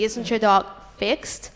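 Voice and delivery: normal talking voice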